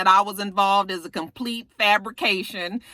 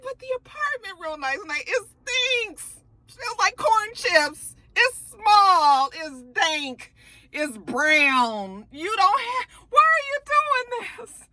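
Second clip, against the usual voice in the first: high, drawn-out pleading whine; high-pitched despairing plea, slowing at the end; whiny pleading; 12 semitones higher, slower than usual. Retold or imitated. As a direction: beg him to reconsider, almost crying. Pleading with Nate.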